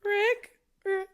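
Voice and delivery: high, strained Morty squeak; nervous Morty. Acted. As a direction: sound scared and unsure. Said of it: "He's always sounding nervous".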